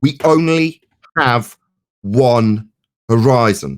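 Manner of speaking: slow, spaced-out words with pauses; emphatic repeat. Real, not acted. Debater repeating a statement of fact to challenge Brenda, slow and emphatic. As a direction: spell it out word by word, very slowly. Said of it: "I will repeat it now".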